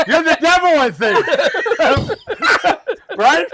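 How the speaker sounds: high, laughing accusation. Real, not acted. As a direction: giggle it out as a joke. Laughing at the caller.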